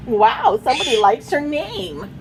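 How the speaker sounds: ordinary delivery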